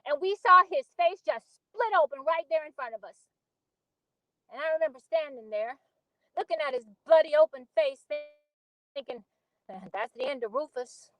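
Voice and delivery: put-on earnest storyteller recalling a childhood accident; Beneatha monologue. Auditioning actor recalls Rufus's sledding accident, earnest dramatic monologue.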